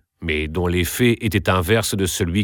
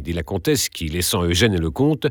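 ordinary delivery

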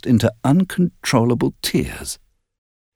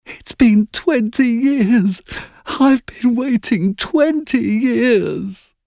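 high, very slow wailing plea; crying ghost child; much slower than usual. A narrator's reading. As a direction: beg through tears to come in. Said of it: "the child cried again".

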